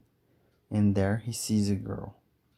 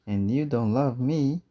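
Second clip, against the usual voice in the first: higher and louder shot back at her; husband hits back at wife, higher and louder; retort; 5 semitones higher, 6 dB louder than usual. Acted. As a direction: fling the same words straight back. Couple quarrel in boat.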